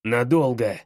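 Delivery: low, curt father's voice; gruff father's answer. Acted. Father answers son from another room, curt and low.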